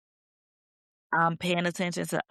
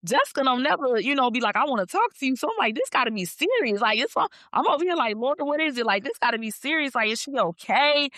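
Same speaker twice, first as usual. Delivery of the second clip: higher-pitched mock worry; retold alarm; 6 semitones higher than usual. Friend retelling her reaction to a serious message, worried. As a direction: replay a worried reaction, voice lifted high.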